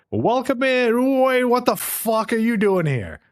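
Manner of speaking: pub hostess greeting. Retold or imitated. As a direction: put on a high-pitched welcome that becomes a mock outburst. Pub lady's greeting turns hostile.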